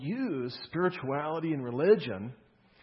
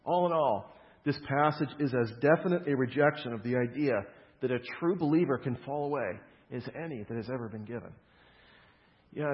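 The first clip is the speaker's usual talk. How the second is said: ordinary talk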